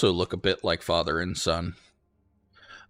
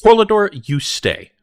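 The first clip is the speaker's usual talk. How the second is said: stern lord voice; 4 semitones higher, 5 dB louder than usual. Acted. Lord mayor orders nobles home.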